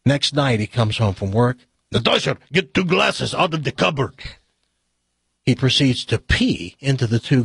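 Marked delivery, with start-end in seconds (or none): 1.9-4.2 comic Russian husband giving orders; bad Russian joke accent; mixed-up Russian accent. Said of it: "this Russian accent's gonna sound like".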